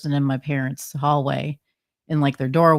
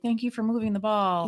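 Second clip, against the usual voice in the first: high-pitched, re-enacted thank-you; imitated excited thanks; 7 semitones higher than usual. Investigator replaying her thanks to a child spirit, excited and high.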